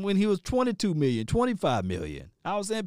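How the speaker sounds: ordinary delivery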